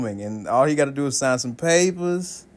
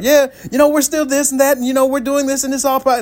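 upbeat husband; 12 semitones higher, 3 dB louder than usual. Retold or imitated. Husband's cheerful account.

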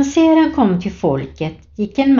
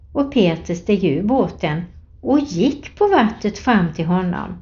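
ordinary talk

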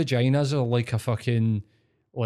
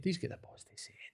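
whispered aside; 7 semitones higher, 12 dB quieter, much faster than usual. Real, not acted.

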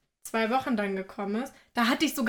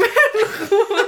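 laughing; 11 semitones higher, 10 dB louder than usual. Real, not acted. Groaning about work groups, laughing.

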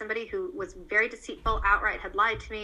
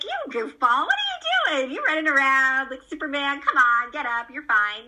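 higher, teasing motherly brush-off; playful mom; 4 semitones higher, 5 dB louder than usual. Acted. Mom making light of her child's fall, playful. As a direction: tease a fallen kid back up. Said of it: "But if Mom's like".

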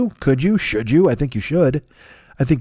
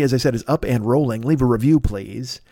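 ordinary talk

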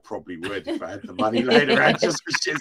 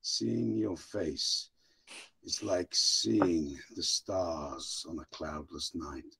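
slow, low, hushed romantic English gentleman; lower, slower, hushed lovesick poet; very English farewell; 10 semitones lower, 5 dB quieter than usual. Pretend. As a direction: woo her softly like a courtly Englishman. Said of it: "say bye to Vicky in the very English way".

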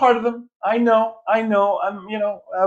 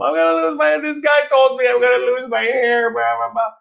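put-on high-pitched, upset child's complaint; upset kid; 9 semitones higher than usual. Boy telling his mom about the barber, whiny and upset. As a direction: mimic a twelve-year-old whining about his hair. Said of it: "my mom saw I was so upset".

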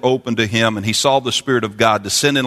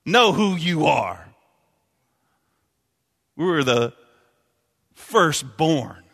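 shouting preacher; 10 semitones higher, slower than usual. Acted. Preacher shouting to the church that we're God's babies. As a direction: shout it out with joy.

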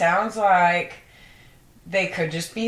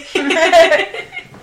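loud burst of laughter; 11 semitones higher, 9 dB louder than usual. Real, not acted. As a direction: crack up loudly. Big laugh at joke.

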